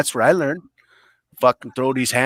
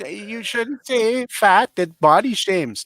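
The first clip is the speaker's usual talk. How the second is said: high, slow prissy scolding; mocking scold; 8 semitones higher, slower than usual. Imitating critics who tell him not to say fat, high slow scold. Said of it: "Why is everyone".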